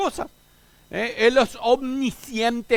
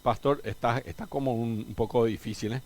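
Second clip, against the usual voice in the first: low, subdued grumble of a listener finding it hard; imitating puzzled congregation; 8 semitones lower, 10 dB quieter than usual. Pastor imitating his congregation's reaction to hard teaching, lightly voiced and subdued. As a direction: lightly voice a daunted churchgoer, much softer. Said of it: "Yo sé que para ustedes dicen".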